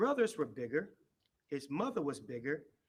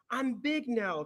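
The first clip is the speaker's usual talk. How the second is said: higher, louder happy call; excited cry; 8 semitones higher, 6 dB louder, slower than usual. A narrator's reading. Little hippo runs past the elephant, crying out proudly. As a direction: shout it out with glee. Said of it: "cried Little Hippo".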